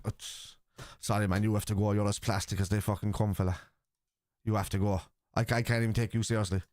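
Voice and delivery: ordinary talk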